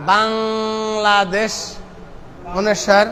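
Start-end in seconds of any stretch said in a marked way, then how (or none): 0.1-1.5 বাংলাদেশ with a long held ng after the first vowel; stretched anusvara. Shown as a demonstration. Teacher gives an example, stretched ng in বাংলাদেশ. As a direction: hum the ng in বাংলাদেশ, slow and drawn out. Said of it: "বাংলায় অনুসারের উচ্চারণ".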